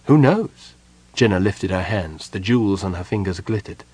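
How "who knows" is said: higher, louder toss-off; a hint of a sharp sorceress. A narrator's reading. Jenna brushes off Parlin's question, raised. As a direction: throw the question back, lightly voiced.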